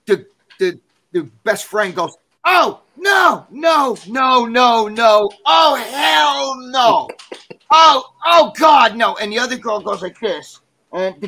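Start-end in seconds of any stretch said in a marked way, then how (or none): 2.4-7.1 high, loud, drawn-out refusal; horrified shouted rejection. Retold or imitated. Best friend rejecting the contestant on sight, horrified shout. 7.7-9.1 high, very loud cry of refusal; horrified shouted rejection. Retold or imitated. Best friend seeing the date under the light, horrified shout.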